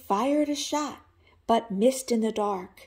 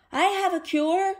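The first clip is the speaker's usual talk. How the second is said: louder, higher call from below; shouted up; 8 semitones higher, 5 dB louder than usual. Acted. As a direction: call up to the wolf. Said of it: "The duck shouted up".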